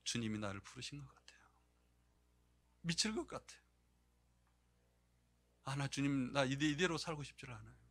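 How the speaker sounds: tearful plea. Retold or imitated. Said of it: "그러면서 우는 거예요".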